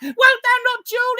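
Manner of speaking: put-on playful warning to the child; merry husband teasing. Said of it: "quoth my husband".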